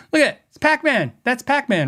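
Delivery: high, fast, giggly amazement; laughing excitedly. Real, not acted. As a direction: laugh while pointing it out. Amazed at the Pac-Man flanger knob.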